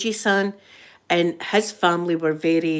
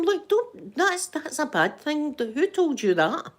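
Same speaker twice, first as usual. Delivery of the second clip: mock scolding; 7 semitones higher than usual. Imagined scolding of colleagues.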